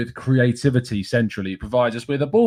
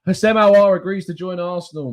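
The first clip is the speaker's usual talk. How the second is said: normal talking voice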